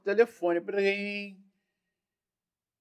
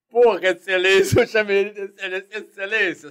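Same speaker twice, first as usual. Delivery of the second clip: respectful nervous reply; 5 semitones higher, 8 dB louder than usual. Retold or imitated. Detective answering the general.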